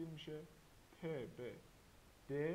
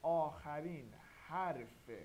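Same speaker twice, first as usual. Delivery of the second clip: slow emphasis; 5 dB louder, slower than usual. Shown as a demonstration. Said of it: "خیلی خوب گوش کنید".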